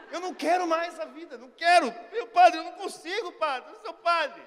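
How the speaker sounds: loud, high, dramatic pleading; hammed-up despair. Seeker begging the priest for life's meaning, dramatically desperate.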